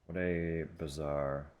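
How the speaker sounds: ordinary talk, nothing marked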